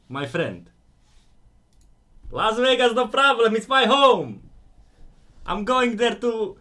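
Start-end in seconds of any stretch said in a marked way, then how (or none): 2.3-6.6 loud, high-pitched exclamation; excited boast. Real, not acted. Streamer boasting to viewers that Vegas is home, excited and loud.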